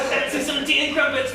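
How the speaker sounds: high, hammed-up British accent; put-on British voice, high and silly; mock British accent. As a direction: imitate a cheesy British greeting, pitched well up. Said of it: "obliged to do their British accent".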